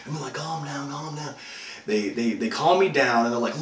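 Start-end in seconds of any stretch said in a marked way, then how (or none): 0.3-1.4 softer, hushed imitation of calming someone; soothing. Captors calming the panicking victim, soothing. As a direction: settle him down with a lowered volume.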